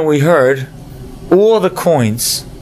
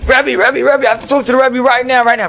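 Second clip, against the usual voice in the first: much higher, breathless panic; frantic; 9 semitones higher than usual. Acted. Student bursts in to the Rebbe, frantic high panic. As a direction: burst in desperate and rushed. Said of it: "comes running in, frantic".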